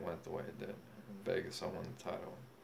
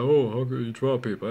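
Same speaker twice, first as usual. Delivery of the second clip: mocking; 5 semitones higher, 13 dB louder than usual. Mocking a critic's words.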